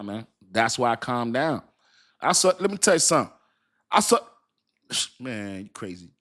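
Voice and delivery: ordinary delivery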